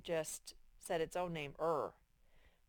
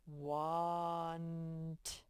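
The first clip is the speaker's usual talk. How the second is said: slow sounding out. Shown as a demonstration. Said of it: "It makes the ah sound".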